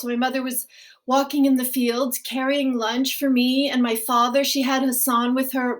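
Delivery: ordinary delivery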